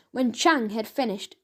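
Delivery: ordinary delivery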